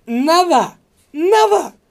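high, full-voiced yell on each word; retold outraged shout. Patient leaving hospital after mix-up, yelling furiously. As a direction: shout it twice with a pause between.

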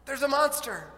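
put-on high, loud frightened child's voice; scared child. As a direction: tell dad about the monster, shaken. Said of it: "He said".

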